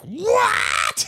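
put-on a stunned older sister, pitch way up; mock gasp of disbelief; shocked what.

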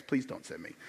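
low, soft retold plea; begging. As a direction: plead it softly, as if imitating a beggar. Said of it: "basically just begs".

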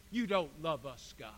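low, dropped sulky accusation; sulky complaint. Acted. People talking back to God, sulky. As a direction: drop low as if let down.

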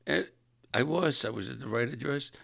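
normal talking voice

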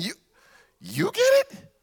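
high soft incredulous rise. Retold or imitated. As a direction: ask it in shocked disbelief.

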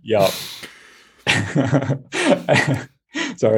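ordinary talk

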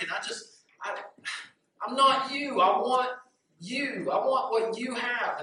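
high, envious gushing; gushing admiration. Acted. Crow gushes enviously at swan.

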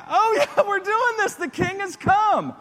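high-pitched, hyped-up disciples; freaking out with excitement. Acted. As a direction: crow it triumphantly as the excited crowd. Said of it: "they're, like, freaking out".

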